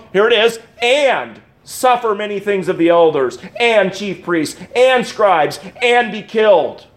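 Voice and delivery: each "and" leaned on and set apart; stress on every and. Shown as a demonstration. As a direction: read the list hitting every "and". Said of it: "why are you emphasizing that word".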